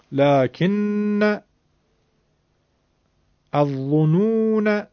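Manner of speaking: لکن الظنون الرسول each drawn out slowly with a short final fatha; careful recitation. Shown as a demonstration. The teacher lists the seven words for the class.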